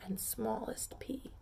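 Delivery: whispered softly, just breath; hushed, unvoiced narration. A narrator's reading. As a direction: breathe it out for the tiniest pea. Narrator whispers the small pea.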